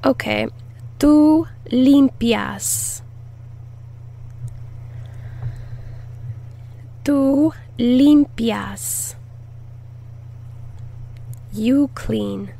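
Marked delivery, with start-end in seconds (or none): 1.0-9.2 tú limpias said slowly, tú lifted; slow teaching model. Shown as a demonstration. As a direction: draw out tú limpias for the class. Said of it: "teacher models conjugation".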